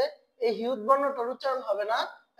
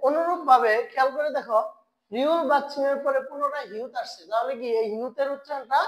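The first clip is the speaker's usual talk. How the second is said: ordinary talk, nothing marked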